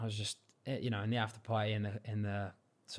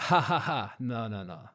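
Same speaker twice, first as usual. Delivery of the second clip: imitated dismissive laugh, then brushing it off; mock a loud "ha ha ha", settling into refusal; 6 dB louder than usual. Retelling the judge laughing off his complaint, mocking laugh. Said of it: "he was, like, laughing, laughing at me".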